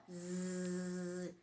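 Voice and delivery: "Zzz" as a low, soft bee buzz. Shown as a demonstration. Teacher imitating a bee to show the Z. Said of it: "O Z se fala assim".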